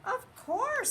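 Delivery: squeaky high squirrel voice; high welcoming squirrel. A narrator's reading. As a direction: pipe it up high like a tiny squirrel. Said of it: "said Squirrel".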